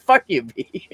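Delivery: unvoiced-style whispered comeback, imitated; mock cussing under the breath; whispered retort. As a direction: whisper it like a retold insult. Retold boss's cussing reply.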